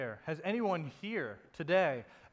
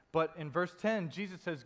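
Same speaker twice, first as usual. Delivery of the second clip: ordinary delivery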